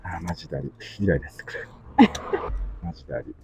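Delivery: muttered under the breath, fed up; low grumbling complaint while working; fed-up grumble. Real, not acted. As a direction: grumble to yourself, annoyed.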